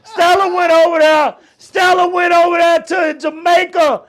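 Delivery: high, loud comic outburst; hyped-up hollering. Acted. Host riffing on Stella's Jamaica trip, hollering. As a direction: shout it out, riled up and playful.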